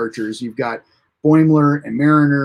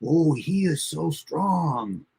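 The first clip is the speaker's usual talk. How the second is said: soft, slow mock admiration; hushed, hammed-up Pakled awe; awed Pakled crowd; 7 dB quieter, much slower than usual. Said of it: "everyone's like".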